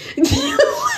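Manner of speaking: squealing laugh way up high; helpless high laugh; high squeal of laughter. Real, not acted. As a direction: shriek with laughter. Cracking up at her own story.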